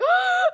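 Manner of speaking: sharp high gasp, imitating sobbing. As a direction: suck in air like mid-sob. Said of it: "like, I'm gasping".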